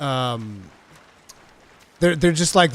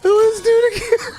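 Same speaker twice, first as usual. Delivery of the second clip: squeaky, much higher put-on cartoon voice; 4 dB louder than usual. Trolling the sleeping player in a squeaky cartoon voice. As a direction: play a goofy squeaky character.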